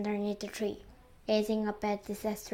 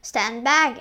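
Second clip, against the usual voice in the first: louder, mild warning shout; 9 dB louder than usual. A narrator's reading. An aunt warns as the branch bends, shouted. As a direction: call out a warning. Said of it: "and Spike shouted".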